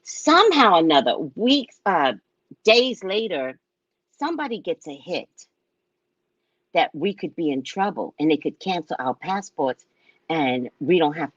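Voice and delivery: ordinary talk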